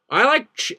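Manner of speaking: high, loud stab at an American accent; attempted American accent. Shown as a demonstration. Streamer starts a requested line for chat, attempted American accent. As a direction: launch into a put-on American accent. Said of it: "do your best American accent".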